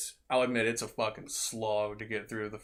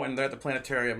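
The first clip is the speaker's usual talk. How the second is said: normal talking voice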